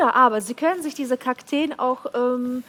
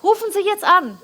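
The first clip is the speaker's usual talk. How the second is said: high, pushy sales call; infomercial; 6 semitones higher than usual. Acted. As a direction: urge viewers to buy now. Teleshopping call to action.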